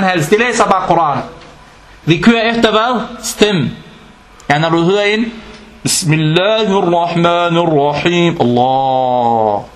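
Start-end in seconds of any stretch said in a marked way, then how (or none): 5.8-9.6 mock melodic recital. Mimicking a melodic Quran reading.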